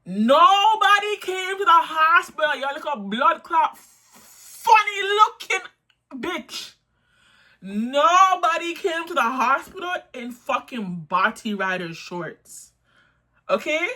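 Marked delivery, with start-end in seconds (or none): 0.0-11.5 much higher and louder, heated; angry rant. Real, not acted. Ranting at the coworker, loud and heated. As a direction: blast the accuser.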